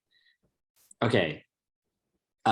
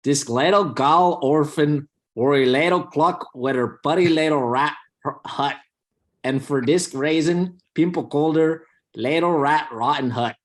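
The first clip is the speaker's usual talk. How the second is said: lilting Scottish accent, slowed down and pitched up; 4 semitones higher, 3 dB louder than usual. Shown as a demonstration. Reading a nonsense fairy tale on request, in a Scottish accent. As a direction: read it like a lively Scotsman. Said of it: "I wanna hear [name] read it in a Scottish accent".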